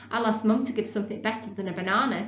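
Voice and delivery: ordinary delivery, nothing marked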